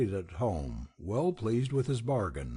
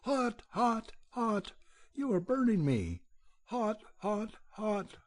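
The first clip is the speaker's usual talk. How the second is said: high shrieking cry of a scalded badger; shrill shriek; 11 semitones higher than usual. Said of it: "shrieking shrilly".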